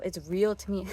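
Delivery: usual speaking voice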